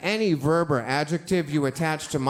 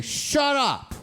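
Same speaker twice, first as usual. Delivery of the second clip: much higher, yelled retort; snapping shout; 12 semitones higher than usual. Retold or imitated. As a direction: finally explode at the bully. Boy finally snaps at bully.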